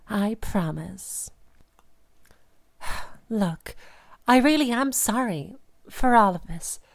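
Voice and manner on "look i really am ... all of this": ordinary delivery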